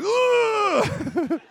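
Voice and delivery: high-pitched screech of frustration; mock exasperated scream. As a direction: imitate a wife at her wits' end, loudly. Imitating wife's exasperated reaction.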